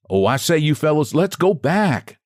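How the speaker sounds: a nervous little man pleading with his fellows; little man crying to his companions to go back; plaintive cry. A narrator's reading. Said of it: "cried he".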